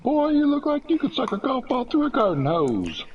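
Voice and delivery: high, loud barking drill sergeant. Acted. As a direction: bark it like a drill instructor. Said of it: "That's my T I voice".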